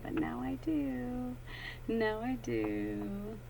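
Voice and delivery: soft, slow hushed understanding; hushed realisation. Real, not acted. Realising why the crystal moved, soft and slow. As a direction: let it dawn on you quietly.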